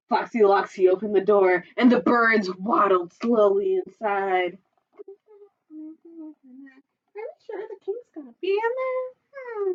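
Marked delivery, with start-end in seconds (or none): 4.9-9.7 nervous hush. Acted. Nervous bird asks.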